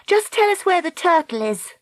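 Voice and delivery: high-pitched, impatient demand; exasperated young heroine; cried out. A narrator's reading. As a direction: plead insistently in a high voice. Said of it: "she cried".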